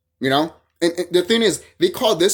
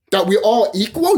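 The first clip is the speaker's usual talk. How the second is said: normal talking voice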